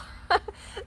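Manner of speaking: light airy giggle; breathy giggle. Real, not acted. Laugh after plan.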